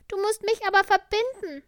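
sick little tiger. Acted. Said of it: "rief der kleine Tiger".

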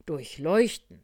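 loud, emphatic single word; doctor's answer. Acted. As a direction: pronounce it loudly like an expert. (laute, betonte Arztantwort)

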